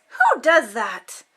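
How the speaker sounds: ordinary delivery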